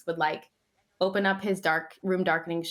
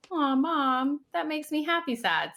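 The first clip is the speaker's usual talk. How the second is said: imitating son; 7 semitones higher than usual. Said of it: "he's like".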